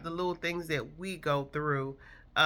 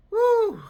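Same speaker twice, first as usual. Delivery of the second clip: excited woo; 10 dB louder than usual. Real, not acted. Amazed at baby's recovery.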